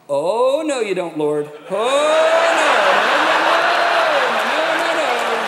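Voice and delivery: loud pleading cry of no, rising high; inner protest. Retold or imitated. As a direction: beg the Lord loudly to stop this.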